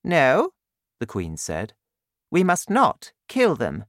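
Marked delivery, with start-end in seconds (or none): 0.0-0.5 firm queen. Acted. Queen forbids killing. 2.3-3.9 firm queen. Acted. Queen voiced in story.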